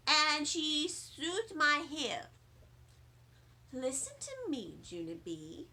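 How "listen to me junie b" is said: lower, softer mom voice; calm a child down; soft, patient mother; gentle mother. Acted.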